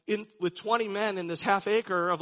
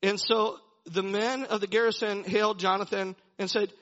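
usual speaking voice

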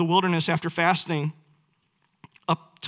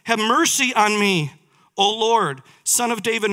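raised, louder plea as read from scripture; earnest preacher's rendering of a cry for help; 6 semitones higher, 5 dB louder than usual. A narrator's reading. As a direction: call out for mercy, voice lifted. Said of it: "and cried out to him".

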